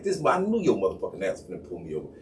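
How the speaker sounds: low, frustrated grumble; understated exasperation. Retold or imitated. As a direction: grumble to yourself as you get pulled over. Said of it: "'cause I'm frustrated".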